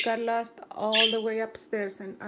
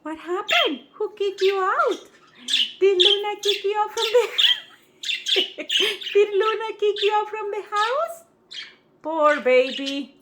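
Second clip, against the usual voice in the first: high, cooing pet voice, much higher; 11 semitones higher, 8 dB louder than usual. Real, not acted. Owner cooing to her kicked-out bird, high baby talk.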